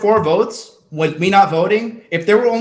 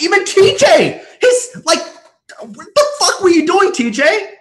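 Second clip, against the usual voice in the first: incredulous; 10 semitones higher, slower than usual. Real, not acted. Disbelief at a teammate's vote.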